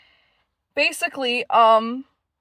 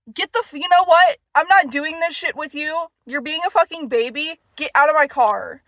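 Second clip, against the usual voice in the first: angry yelling; 5 semitones higher than usual. Retold or imitated. Kicking boyfriend out of car.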